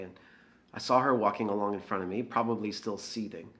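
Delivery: ordinary talk, nothing marked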